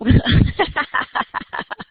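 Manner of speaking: real amused laugh, rapid pulses; tickled laughter. Real, not acted. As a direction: burst out laughing, then trail off. Amused by something funny.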